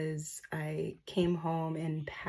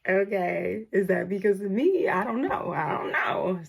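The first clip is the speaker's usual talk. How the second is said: flustered surprise; 2 semitones higher, 6 dB louder than usual. Retold or imitated. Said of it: "And I was like".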